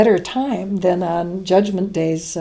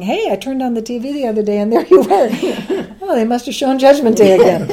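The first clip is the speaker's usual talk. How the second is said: laughing quote; 5 semitones higher, 6 dB louder than usual. Retold or imitated. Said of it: "an email from somebody saying".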